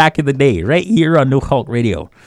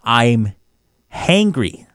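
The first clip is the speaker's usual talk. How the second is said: ordinary talk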